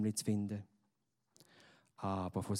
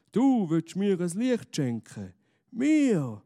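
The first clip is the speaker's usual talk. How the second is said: high, loud disbelief; astonished; 8 semitones higher, 6 dB louder, slower than usual. A narrator's reading. Stranger astonished at the boy's gift, high and loud. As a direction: sound amazed, voice up and loud. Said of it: "wundert sich der Mann".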